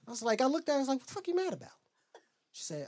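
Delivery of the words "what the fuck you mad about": high, hushed disbelief; baffled protest. Retold or imitated. Answering his mad girlfriend, high quiet bafflement. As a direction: squeak it out softly, baffled.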